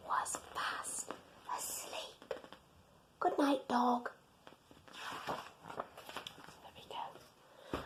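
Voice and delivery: whispered, soft and slow; bedtime whisper. Real, not acted. Narrator whispering good night to sleeping Dog. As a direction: hush it so he stays asleep. Story ends, dog asleep.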